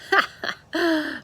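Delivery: a short, high chuckle of giving in; laugh, amused despite yourself; hearty laugh. A narrator's reading. Mister Rogers laughing at Amelia Bedelia's reply, short chuckle. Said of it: "Mister Rogers laughed".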